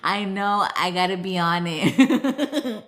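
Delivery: ordinary delivery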